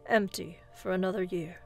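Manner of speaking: ordinary delivery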